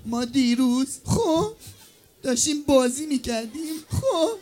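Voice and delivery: soft, halting mock-tearful voice; injured schoolgirl. Pupil telling teacher how the hand broke, pretend crying. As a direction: tell it piecemeal, ending in a whimper. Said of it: "مثلاً من اون دخترم که دستش شکسته".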